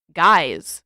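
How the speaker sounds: loud call to cut everyone off; interrupting call. Acted. Sokka cuts in on his friends with a loud call. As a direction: bark it to get their attention. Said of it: "Sokka interrupted".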